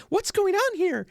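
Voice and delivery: put-on very high, alarmed young woman; a shopgirl asking what's going on, startled; startled seamstress. Seamstress startled as the men pull the blinds, high and alarmed.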